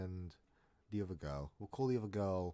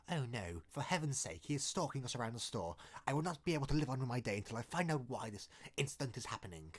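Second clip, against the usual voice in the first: put-on, over-formal voice of dismay; hammed-up proper outrage; mock-proper lament; 3 semitones higher than usual. Storyteller mocks sitting there helpless while stalked, in mock-proper dismay. Mocking an imagined prim reaction.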